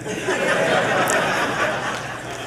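soft breathy laugh at his own joke; brief unvoiced laugh; real laugh. Real, not acted. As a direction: let out a quiet real chuckle.

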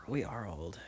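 whispered, unvoiced aside; whispering. Real, not acted. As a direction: whisper it in mock dread.

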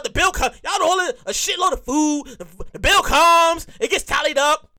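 ordinary talk

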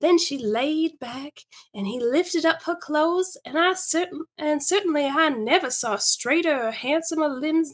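animated story reading with big pitch swings; lively, theatrical narration; [name]'s storytelling. Acted. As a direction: relish the tale for an audience of friends.

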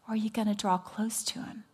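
whispered invitation, close and hushed; a hushed plea to come close; soft whisper. Real, not acted. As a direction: draw them in with a whisper. Intimate altar call question.

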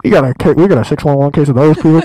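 loud dispatcher call; police radio. Pretend. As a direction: bark it like a dispatcher.

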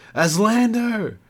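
high, drawn-out desperate cry; trapped woman crying out to Eslander, high and desperate. A narrator's reading. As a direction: call for rescue with all she has. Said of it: "she cried out".

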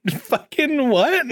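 very high, slow disbelief; mock shocked crowd. Everybody reacting to the new manager, mock shocked. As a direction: stretch it into a hammed-up squeal of disbelief. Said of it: "everybody just goes".

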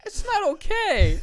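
high, squeaky Mario-like voice; a hint of Mario, squeaky. Real, not acted. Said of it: "Why did I sound like Mario?".

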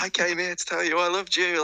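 high, breathy-free mock love declaration; lightly mocking the contestant; mock dramatic confession. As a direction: ham up a cheesy TV confession. Quoting Love Island contestant.

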